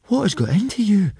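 ordinary delivery

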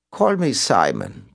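put-on slow European-accented reply; Simon, European accent. A narrator's reading. Mystery caller names himself to police, European accent. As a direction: introduce yourself slowly, European accent. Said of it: "recited in a European accent".

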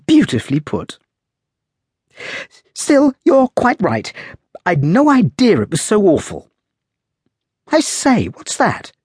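put-on light, high, lively young lady; playful high girl's voice, teasing; young woman's voice. A narrator's reading. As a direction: raise the voice into a spirited young woman. Girl voiced in story.

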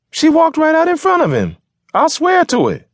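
loud, very high keening protest; keen it out, insisting on your story. Acted. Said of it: "argued in a high, keening voice".

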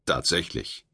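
low, falling, sure "Tatsächlich."; affirming. A narrator's reading. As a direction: state it flatly as fact. Firm answer in dialogue.

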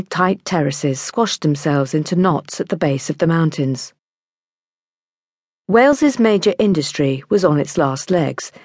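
ordinary delivery

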